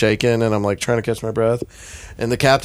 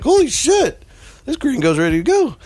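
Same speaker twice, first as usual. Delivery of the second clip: high, thrilled mock exclamation; excited captain; 14 semitones higher, 3 dB louder than usual. Captain surprised the tourist wants back in, excited. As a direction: play the surprised captain cheering him on. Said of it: "he kinda got excited".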